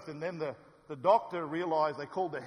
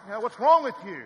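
loud, higher yell at passing cars; yelling at speeders; 7 semitones higher, 11 dB louder than usual. Retold or imitated. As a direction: bellow at the speeding drivers. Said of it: "honking at them and thinking".

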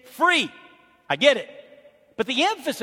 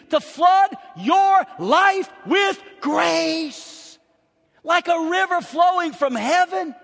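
high, loud pulpit exclamation; impassioned preaching; 9 semitones higher, 4 dB louder than usual. Real, not acted. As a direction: preach it out with full force. Preaches at full force.

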